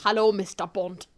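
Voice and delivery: low German-accented greeting; German villain demo. Shown as a demonstration. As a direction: show how a German villain says it. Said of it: "Are you German now?".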